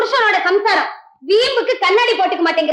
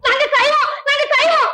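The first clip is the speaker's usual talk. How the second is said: high mocking retort; 6 semitones higher than usual. Quarrel, mocking repetition.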